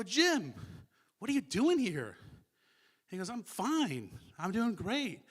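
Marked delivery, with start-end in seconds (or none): 0.0-2.2 high, loud, amazed call; retold astonished greeting. Speaker spotting Jim in the dream, astonished. Dream meeting with late friend. 3.3-5.2 high, emphatic reassurance; retold upbeat reassurance. Jim in the dream answering his friend, upbeat.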